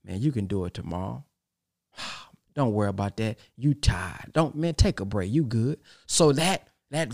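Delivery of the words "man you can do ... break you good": low, soft lazy tempter with a sigh; devil voice. Retold or imitated. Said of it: "Here comes the devil voice".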